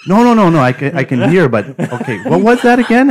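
insist, laughing in disbelief; exasperated protest. Retold or imitated. Insisting he can hear.

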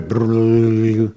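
loud, long rolling brrr of babble; drawn-out trilled murmur noise; imitated crowd murmur. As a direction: mimic the rumble of thousands of voices.

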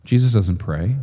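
normal talking voice

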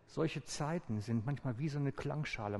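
usual speaking voice

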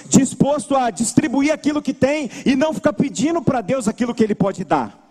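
ordinary talk

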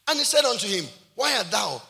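loud, projected Bible reading; scripture reading. Real, not acted. Preacher reading Jonadab's question to the congregation, loud scripture reading. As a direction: read the verse out to the whole hall.

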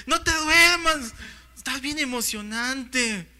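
loud, high, drawn-out mock plea; hammed-up telenovela fan, slow and insistent; excited wife. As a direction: imitate a wife nudging her dozing husband. Acting wife waking him during telenovela.

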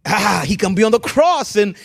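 loud half-sung preaching cry; preaching shout. Real, not acted. Pastor cries out to the congregation, loud and sung. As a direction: ring it out like a preacher in full flight. Preacher erupts mid-sermon.

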